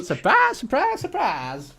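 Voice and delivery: put-on Liverpool accent. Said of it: "he can't do a Liverpool accent".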